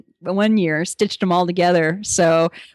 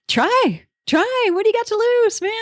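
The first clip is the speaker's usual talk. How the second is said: coaxing; 14 semitones higher than usual. Retold or imitated. Urging people to just ask.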